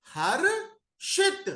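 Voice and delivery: very slow, high हर्षित; sounding out हर्षित. Shown as a demonstration. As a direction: drag हर्षित out. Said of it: "shit".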